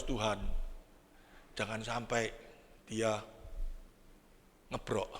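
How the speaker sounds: low, soft pleading prayer under the breath; hushed prayer. Retold or imitated. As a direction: pray quietly, almost to yourself. Silent prayer in the car.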